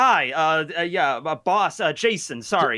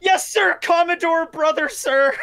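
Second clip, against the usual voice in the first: flustered nervous yes sir; 11 semitones higher than usual. Acted. Flustered brother answering.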